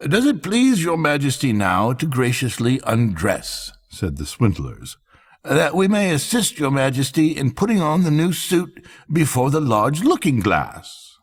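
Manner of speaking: bow and flatter; fawning swindlers. A narrator's reading. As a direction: swindlers coaxing the emperor to undress. Swindlers addressing the emperor.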